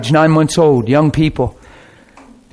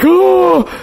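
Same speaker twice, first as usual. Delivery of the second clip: sudden high oh of surprise; surprised gasp word; 12 semitones higher than usual. Retold or imitated. The shaken groomsmen reacting, a high surprised oh. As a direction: jump up in pitch, startled. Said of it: "they were like".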